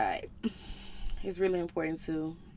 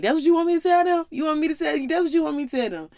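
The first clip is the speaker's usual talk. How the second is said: higher, louder indignant retort; mock-furious rant at God, pitched up and loud; snapping at God; 5 semitones higher, 5 dB louder, faster than usual. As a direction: throw the questions back at God, furious. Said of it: "Justice was snapping".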